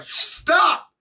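sudden high yelled Stop!; pretend yelled stop. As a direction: cut them off with a sharp cry. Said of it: "gotta pause and be like".

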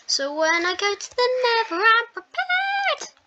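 high, triumphant squeal; mock-heroic high cry. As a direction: announce it like a hero ready for battle. Triumphant gaming boast.

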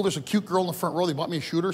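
ordinary talk

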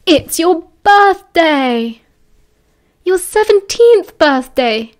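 high, loud, slowly drawn-out cheer; excited announcement. A narrator's reading. James and Sirius wake Remus for his birthday, loudly excited. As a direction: stretch the words out in celebration.

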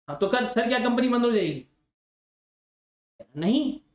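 ordinary talk, nothing marked

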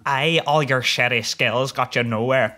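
slow, lightly voiced Irish accent; Irish taunt again. The player quoting the Irish opponent's taunt, mild Irish accent. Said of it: "look what this Irish bollock says to me".

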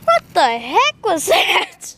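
ordinary talk

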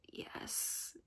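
very soft, sighed answer; sad. A narrator's reading. Tin Man admitting he groaned, soft and sad. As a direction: answer faintly and sadly. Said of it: "the sad voice in which the man spoke".

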